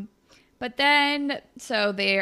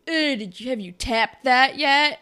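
bro voice; 5 semitones higher, 6 dB louder than usual. Retold or imitated. Retelling a show, teen boy teases his friend in a raised bro voice. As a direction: nudge your buddy with a crude grin. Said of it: "and Moose is like".

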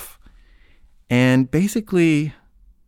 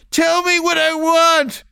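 very high, slow wailed demand; acted-out entranced shriek; weird trance voice; 3 dB louder, slower than usual. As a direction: stretch each word in a strange high squeal. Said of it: "her voice is, like, shifting".

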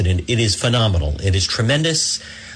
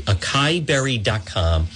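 usual speaking voice

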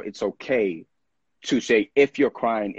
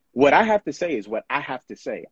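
usual speaking voice

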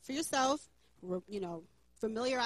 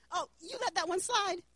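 usual speaking voice

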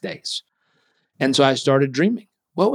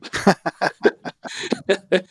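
a burst of high laughter; amused high laugh at dad's useless advice; 6 semitones higher than usual. Real, not acted.

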